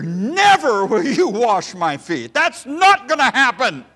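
flat refusal, each word hammered; emphatic refusal. Acted. Peter refusing his master, loud and hammered home. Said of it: "It is so emphatic here".

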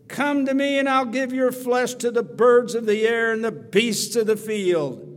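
giant's threat. Acted. Preacher voicing Goliath threatening David, loud roaring threat. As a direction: throw the threat across the valley.

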